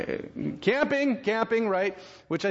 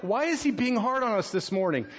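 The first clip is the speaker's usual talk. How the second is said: higher-pitched protesting voice; mock complaint; 8 semitones higher than usual. Congregation questioning the hard preacher, mock complaint. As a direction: pitch it up as a playful grumble.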